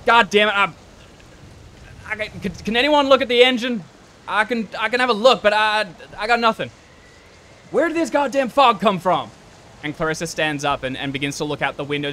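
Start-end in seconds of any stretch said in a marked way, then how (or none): 0.1-9.3 loud, higher, cursing in frustration; agitated Block. Acted. Stranded passenger calling for help with the engine, agitated. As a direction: shout it out, stumbling over the words. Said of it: "And Block goes".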